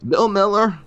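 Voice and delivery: put-on high, loud, tearful old man's voice; a broken old man choking out the news; weeping old man. Old man telling the stranger of his dead kin, weeping.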